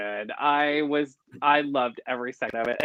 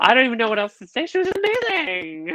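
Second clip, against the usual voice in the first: very high, thrilled praise; gushing; 10 semitones higher than usual. Real, not acted. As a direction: squeal it with delight.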